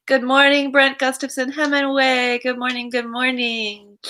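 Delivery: high, sustained singsong hello; singsong greeting. Real, not acted. Greeting Brent as the viewer joins, singsong. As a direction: call it out high, easing off at the end.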